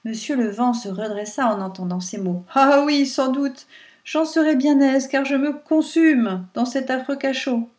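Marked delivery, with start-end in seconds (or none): 2.5-7.7 lightly voiced wind, louder with eagerness; the captive wind jumping at freedom; eager wind. A narrator's reading. Monsieur le Vent answers Pierrot, eager to escape.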